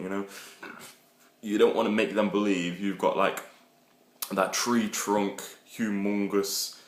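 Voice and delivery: usual speaking voice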